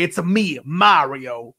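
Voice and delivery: low, understated catchphrase; Mario. Acted. Redoing the Mario line after a weird voice, low-key impression. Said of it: "my voice was, like, weird".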